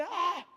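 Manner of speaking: high, short "ah" of realization; imitated startled exclamation; re-enacted reaction cry. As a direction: call out a sudden high "ah". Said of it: "Aí ele".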